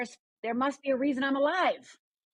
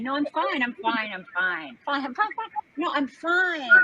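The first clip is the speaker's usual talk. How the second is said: pretending to be fine; 5 semitones higher than usual. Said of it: "The outside Rhonda, who's fine".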